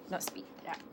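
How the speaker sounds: whispered throwaway remark; whispered aside. Real, not acted. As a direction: whisper it under your breath. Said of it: "unvoiced aside".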